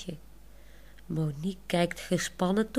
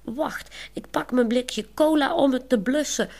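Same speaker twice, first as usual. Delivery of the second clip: louder, higher, full of fire; higher and louder, with zeal; fiery call; 6 semitones higher, 5 dB louder than usual. Acted. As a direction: call it out eagerly to put out the fire. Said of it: "roept ze vol vuur".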